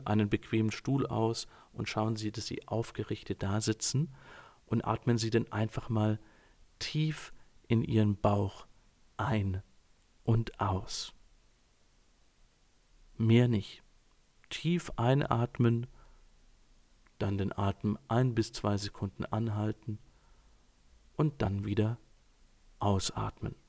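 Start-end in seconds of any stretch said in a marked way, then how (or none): 1.7-11.1 soft, unhurried guided-exercise voice; calm breathing guide. Real, not acted. Coach guiding listeners through a breathing exercise, calm and soft. 14.5-23.5 calm breathing guide. Real, not acted. Coach pacing listeners' breathing in and out, calm and soft. Leading a closing breathing exercise.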